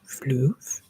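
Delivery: whispered floof. Pretend. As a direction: drop floof into a low whisper. Playing with the word floof.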